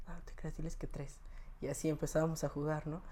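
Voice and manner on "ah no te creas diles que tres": retold ear whisper. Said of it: "entre así en el oído".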